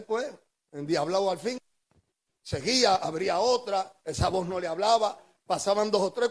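ordinary talk

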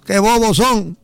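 high-pitched heckle from a passing car; imitating a passerby yelling; retold shout. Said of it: "le gritó, le dijo".

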